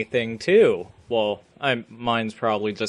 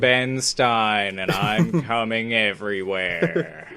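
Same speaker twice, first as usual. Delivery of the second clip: slow monotone drone; deadpan monotone, every word stretched out; Ben Stein; 3 semitones lower than usual. Retold or imitated. Co-host posing as the droning sponsor, slow monotone. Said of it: "with that monotone voice".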